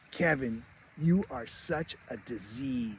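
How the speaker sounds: slow, softened imitation of scorn; disdainful cousin. Cousin chimes in against Kevin, slow quiet disdain. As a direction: deliver a quiet insult, drawing the words out. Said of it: "one of the cousins chimes in".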